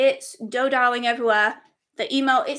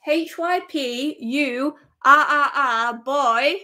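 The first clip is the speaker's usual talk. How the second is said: slow spelling; 3 dB louder, slower than usual. Real, not acted. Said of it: "spelling name".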